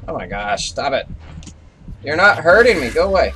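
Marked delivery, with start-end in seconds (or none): 2.0-3.3 raised, defiant call at the creature; loud taunt. Real, not acted. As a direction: shout it to scare it off. Taunting the monster.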